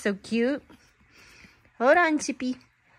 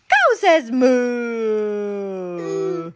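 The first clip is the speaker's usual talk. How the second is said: slow drawn-out reading; 4 semitones lower, much slower than usual. Acted. Parent reading to toddler, slow and drawn-out. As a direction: stretch out cow says moo, very slow.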